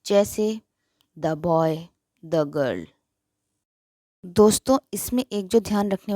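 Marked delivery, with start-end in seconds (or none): 1.2-1.8 put-on "the" said "thuh" before "boy"; short neutral vowel in "the", then "boy". Shown as a demonstration. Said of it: "इसे द बोला जाता है". 2.2-2.9 put-on "the" said "thuh" before "girl"; short neutral vowel in "the", then "girl". Shown as a demonstration. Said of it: "इसे द बोला जाता है".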